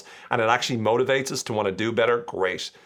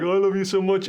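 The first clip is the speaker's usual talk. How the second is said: higher, sweet on-camera voice; online gushing; 9 semitones higher, 3 dB louder than usual. Pretend. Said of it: "They could be like".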